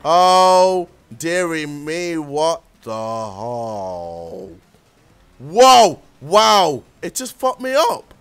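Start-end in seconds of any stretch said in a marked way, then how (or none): 2.2-4.5 slow, stretched-out disbelief; baffled. Real, not acted. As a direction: drag out the question. Drawn-out baffled question.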